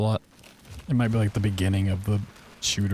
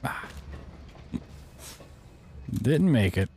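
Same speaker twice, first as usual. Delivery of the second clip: ordinary talk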